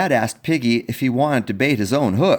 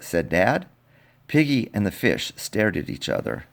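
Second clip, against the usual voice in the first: softer, gentle reading; soft sad narration; 3 semitones lower, 5 dB quieter than usual. A narrator's reading. Storyteller reading the fish scene to kids, softly. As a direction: read the sad part tenderly.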